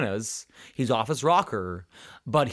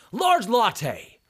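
higher, mock yelled order; retold yelling; 9 semitones higher than usual. Strange customer yelling his order at the barista, mock yell. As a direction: bark the order like a rude stranger. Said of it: "He starts yelling his order at me".

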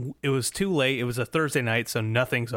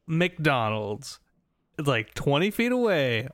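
ordinary delivery

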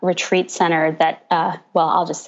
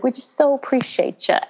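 put-on Southern accent; 5 semitones higher than usual. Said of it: "they just kept saying".

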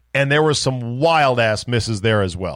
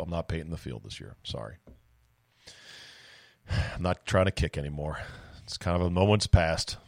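resigned; 6 semitones lower, 9 dB quieter than usual. Real, not acted. Admits giving up on kicking.

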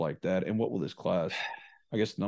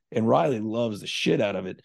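usual speaking voice

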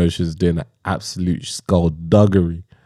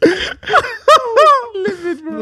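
high squeaky laughing words; laughing hard; 3 dB louder, much slower than usual. Real, not acted. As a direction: laugh through it till you nearly cry. Cracking up over Stevie meme.

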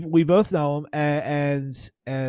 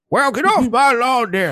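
put-on higher, louder cranky old man; grumpy old man; 9 semitones higher, 7 dB louder than usual. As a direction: shout off the kids like a grump. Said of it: "Okay, boomer".

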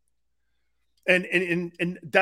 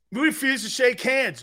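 usual speaking voice

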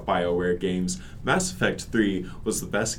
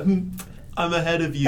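mock-smug taunt, pitched higher with a laugh; higher, laughing one-upmanship; playful gloating; 6 semitones higher than usual. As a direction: lord it over your friends in fun. Teasing friends who watch the anime.

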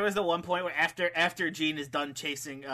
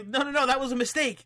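higher, hurried-sounding excuse; mock backtracking; 7 semitones higher than usual. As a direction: plead innocence, hammed-up. Acting out caught wrestler backtracking.

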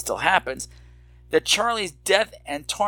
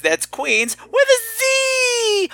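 high, slow, cried-out shout; shouted catchphrase; much slower than usual. Acted. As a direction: belt the catchphrase out. Catchphrase shout ending the plug.

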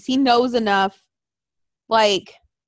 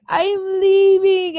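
"I'm leaving." drawn out slowly, higher, in a lightly mocking tearful tone; mock sad farewell; 9 semitones higher, 4 dB louder, slower than usual. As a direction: imitate the wrestler's slow sad goodbye. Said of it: "I love how he's like".

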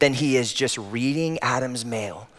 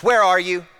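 loud, higher, impatient shout; harsh demanding call; demanding yell; 7 semitones higher, 6 dB louder than usual. Retold or imitated. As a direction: call for him like an angry dad. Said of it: "ask a question demanding his presence".

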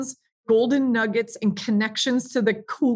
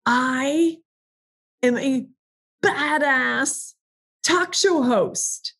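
power statement; 4 semitones higher, slower than usual. Shown as a demonstration. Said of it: "wake up every morning and say".

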